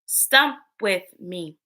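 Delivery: ordinary talk, nothing marked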